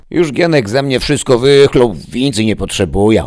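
put-on high, loud old-woman voice; granny's retort. A narrator's reading. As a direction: answer like a tart old grandma. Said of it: "odparła babcia". (głosem babci, zadziornie)

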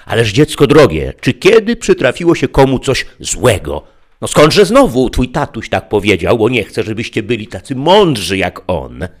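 high, loud, coaxing tempter; sly interpreter. A narrator's reading. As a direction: sweet-talk the child into it. Interpreter reassuring slyly. (wysoki, przymilny głos kusiciela)

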